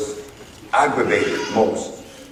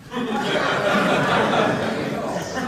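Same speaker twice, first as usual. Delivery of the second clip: breathy high laugh; 8 semitones higher, 4 dB quieter than usual. Real, not acted. Laughs at the judge's remark.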